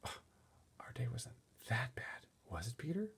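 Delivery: soft unvoiced whisper in a taxi. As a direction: whisper so the sleeping child won't wake. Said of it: "My mother whispered to me".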